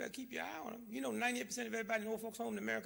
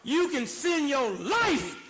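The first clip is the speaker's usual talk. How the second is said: furious preacher yelling at the government; loud, high, outraged tirade; angry shouting rant; 11 semitones higher, 10 dB louder than usual. Real, not acted. Ranting at government spending priorities.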